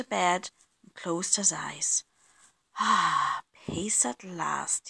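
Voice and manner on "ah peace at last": slow, relieved sigh into hushed words; to himself. A narrator's reading. Mister Bear settles into bed, hushed and relieved. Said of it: "he said to himself".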